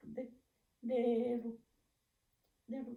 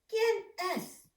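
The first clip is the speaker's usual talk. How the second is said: high, louder startled cry; mouse shouting; 9 semitones higher, 5 dB louder than usual. Acted. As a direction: call out high like a frightened mouse. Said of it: "grita el ratón".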